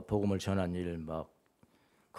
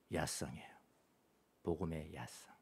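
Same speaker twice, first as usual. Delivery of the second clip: hushed whisper of the key word; whispered reveal; 6 semitones lower, 13 dB quieter than usual. Acted. Pastor revealing the word he heard, whispered. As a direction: let the word land as a secret.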